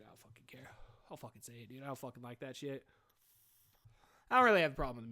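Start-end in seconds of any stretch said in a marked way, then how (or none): 0.0-2.8 muttered. Real, not acted. Muttered aside after impression.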